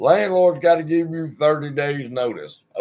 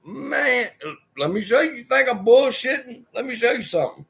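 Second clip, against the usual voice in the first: much higher, heated show-off; mock boast; 10 semitones higher, faster than usual. Telling the tenant he'll prove it, much higher mock boast. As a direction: pitch way up, daring him. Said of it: "You gonna tell him".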